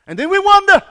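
full-throated revival preaching; preaching at full force. Acted. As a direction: drive it home from the pulpit. Preaching peak.

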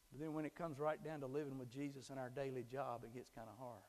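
ordinary talk, nothing marked

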